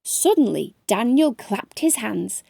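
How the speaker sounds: ordinary delivery, nothing marked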